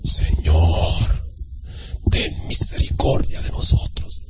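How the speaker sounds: very slow whispered prayer; affected praying voice. Retold or imitated. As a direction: imitate an over-solemn, breathy plea. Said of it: "cambiaba la voz".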